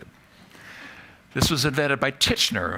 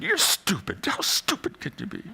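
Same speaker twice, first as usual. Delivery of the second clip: higher, fading to a hiss of self-reproach; mock self-scolding; 8 semitones higher than usual. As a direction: berate yourself in a high voice.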